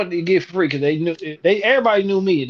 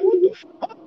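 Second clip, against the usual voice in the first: shocked; 13 semitones higher than usual. Retold or imitated. Retold shock at the call.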